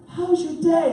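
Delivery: high, chirpy ticket guy; happiest man alive. Retold or imitated. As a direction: greet like the world's happiest person. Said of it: "the most happiest person in the world".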